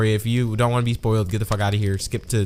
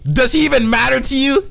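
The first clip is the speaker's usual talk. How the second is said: high, loud demanding question; mock outraged confrontation; 13 semitones higher, 5 dB louder than usual. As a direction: demand it in overacted indignation. Acting out an angry confrontation.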